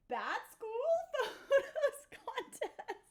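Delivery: title dissolving into high giggles; breaking up laughing. Real, not acted. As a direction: try to say it, fail and laugh. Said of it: "I can't even keep straight face".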